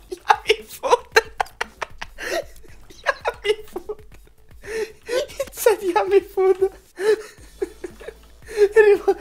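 helpless high squeal of laughter through the words; laughing hard. Real, not acted. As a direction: talk through an uncontrollable laughing fit. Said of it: "Why am I laughing so hard at this?".

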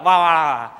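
loud imitated wah wah wah crying; hammed-up sob sounds; mock wailing. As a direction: play-act bawling like a child. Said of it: "讲完了就换我哭了".

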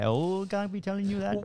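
high-pitched, badly faked Indonesian accent; mock foreign accent for the Uber driver; dubious put-on accent. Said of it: "I don't know about that accent".

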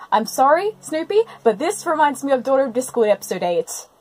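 ordinary delivery

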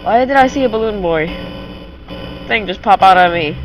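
ordinary delivery

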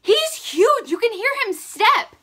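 high, loud, alarmed cry; real alarm. Real, not acted. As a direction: cry out, horrified by a bug. Panicked at the huge cricket.

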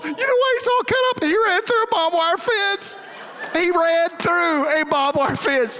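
high, squeaky mocking voice of the kid; teasing family mimics the frightened boy, high squeaky voice; mocking relatives. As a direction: mimic a scared little boy in a shrill falsetto.

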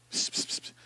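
soft unvoiced psst psst; shushing whisper. Shown as a demonstration. Fearful believer hushing praise, whispered psst. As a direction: whisper like hiding a secret. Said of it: "você fala baixinho".

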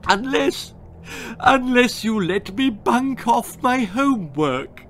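high, whiny plea; tearful bargaining. Acted. Daughter bargaining to skip homework, high whiny plea. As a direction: wheedle through tears.

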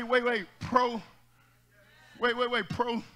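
ordinary delivery

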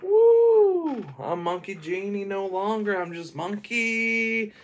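high, loud whoop that slows down at the end; gleeful whoop. Retold or imitated. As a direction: cheer like a freed genie finally let loose. Freed genie cheers.

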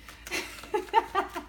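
soft unvoiced chuckle; breathy laugh. Real, not acted. Reader laughing at the viewer's pettiness, soft chuckle.

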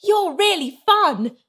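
high, impressed exclamation; excited amazement. A narrator's reading. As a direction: let the wonder lift your voice. Sarah thrilled by alien friend.

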